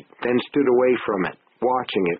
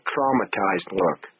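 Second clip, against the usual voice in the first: ordinary delivery, nothing marked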